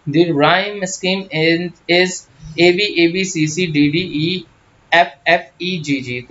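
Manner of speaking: slow, letter-by-letter dictation of "A B A B C C D D E"; dictating letters. Acted. Teacher dictates rhyme scheme letters to students slowly. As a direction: read each letter out for notes.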